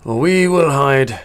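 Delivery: lightly British orc voice; a hint of a British accent on a gruff orc. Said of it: "I don't know why he's got that voice".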